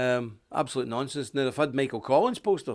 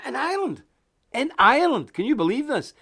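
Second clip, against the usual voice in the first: much higher, In Ireland twice in disbelief; can you believe it, aghast; disbelieving cry to viewers; incredulous outrage; 10 semitones higher, 5 dB louder than usual. Real, not acted.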